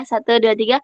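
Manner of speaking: usual speaking voice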